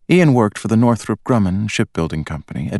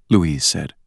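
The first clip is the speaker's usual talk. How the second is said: ordinary delivery, nothing marked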